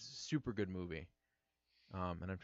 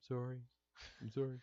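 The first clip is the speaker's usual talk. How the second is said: lightly voiced sorry dog, higher pitch; a hint of a guilty pet's higher voice; apologetic dog; 5 semitones higher than usual. Scolded dog answers his owner, playful apologetic voice. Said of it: "and he'll be like".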